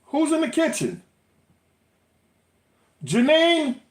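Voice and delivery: calling names loudly through the house; yelling across the room. Real, not acted. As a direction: shout up the stairs to find someone.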